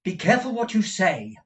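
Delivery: warning. Acted. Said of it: "replied Nicholas".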